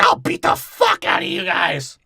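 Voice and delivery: loud, high slurry mock shout; hammed-up stoned mom yelling; drugged-out mom. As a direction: holler slowly as a drugged-out mother making threats. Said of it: "the mom being all drugged out".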